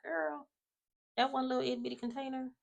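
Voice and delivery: high quick belittling tease; dismissive tease. Retold or imitated. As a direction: brush off her fuss. Teasing TV character.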